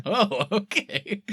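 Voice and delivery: slow, high laughing "oh, okay"; mocking laugh. Podcaster imitating Carmichael mocking the psychic, slow high laughing "oh, okay". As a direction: laugh it off slowly in mock disbelief.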